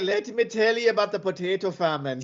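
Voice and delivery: lightly voiced lecturing Irish woman; mock Irish athlete. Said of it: "She was like".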